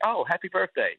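tossed-off high happy birthday; dismissive. Retold or imitated. Fan brushing off the wife, dismissive. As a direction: brush her off in passing. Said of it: "looked at her sorta dismissively".

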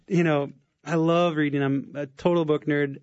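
usual speaking voice

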